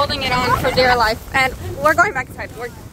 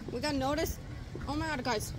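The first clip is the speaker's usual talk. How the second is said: hushed; 15 dB quieter than usual. Real, not acted. Whispering when noticed outside.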